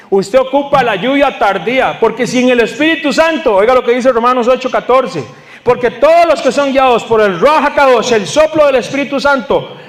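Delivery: high, driving sermon voice; urgent, emphatic proclamation to a congregation; impassioned preaching. Acted.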